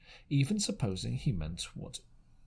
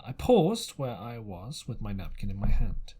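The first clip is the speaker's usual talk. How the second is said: ordinary delivery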